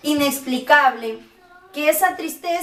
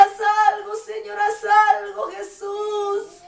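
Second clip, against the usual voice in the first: higher, cried-out plea fading at the end; desperate cry to God; 5 semitones higher than usual. Retold or imitated. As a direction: cry out for help, then break off. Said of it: "Yo le decía". (clamor desesperado, agudo, que se apaga)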